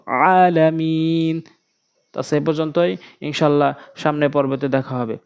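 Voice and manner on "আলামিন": আলামিন opened with a squeezed throat ain, vowels held; slow Arabic recital of আলামিন with ain; ain, not hamza. Shown as a demonstration. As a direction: draw out আলামিন from a tight mid-throat start. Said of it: "আমরা আইন এনে উচ্চারণ করব".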